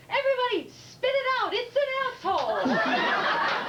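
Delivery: high, loud schoolboy shout; alarmed little boy. Acted. Boy warning the whole class, high alarmed shout. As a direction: yell a warning, stretching the last word. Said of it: "a little boy in the back stands up and he goes".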